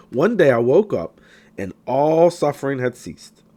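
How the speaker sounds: ordinary talk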